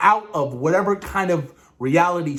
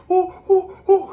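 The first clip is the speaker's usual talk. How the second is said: short, high comic "ooh" cries; playful high-pitched yelps; mock screams; 5 dB louder than usual. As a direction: imitate sitcom childbirth screaming. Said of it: "she screams again and the Vision screams".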